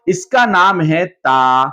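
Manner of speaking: slow, drawn-out model of ता; slow clear naming. Shown as a demonstration. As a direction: lay out the name slowly for the class. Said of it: "अब उसके बाद कहेंगे".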